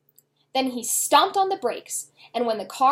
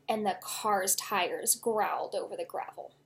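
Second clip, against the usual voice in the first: plain reading voice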